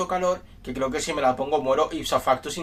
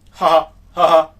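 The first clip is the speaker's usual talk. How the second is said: loud hammy evil cackle; evil laugh; 3 semitones higher, 12 dB louder than usual. Acted. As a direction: laugh like a villain. Villain laugh. (risa malvada)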